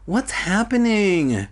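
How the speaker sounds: high, slow, drawn-out groggy question; mock-sleepy high murmur; drowsy murmur. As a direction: ask it as if just waking, stretched out. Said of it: "Sheena murmured".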